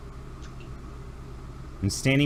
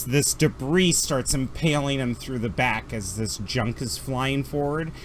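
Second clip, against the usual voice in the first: ordinary talk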